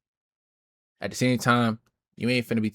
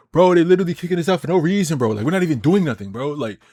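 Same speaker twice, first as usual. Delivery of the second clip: higher, louder mock protest; imitating complaining streamers; 7 semitones higher, 5 dB louder than usual. Streamers complaining about being kicked out, mock-aggrieved. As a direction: play the wronged innocent, voice pitched up. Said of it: "and they be like".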